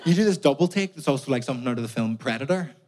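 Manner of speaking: ordinary delivery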